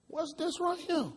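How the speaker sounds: a high, slow, put-on officer voice, drawn out; officer's mock question. Officer questioning the guy he just planted a gun on, high and slow. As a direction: ham up the cop asking with fake innocence. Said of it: "He said".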